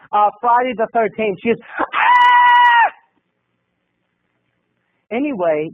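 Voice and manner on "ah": very high, loud, long shriek; imitated horror-movie scream. Girl behind startled by the movie, piercing mock scream. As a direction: scream like it's a slasher film. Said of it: "screamed like we were watching".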